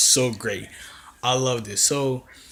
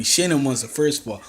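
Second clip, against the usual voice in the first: usual speaking voice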